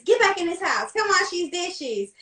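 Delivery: imitated grandma calling her home; grandma's order.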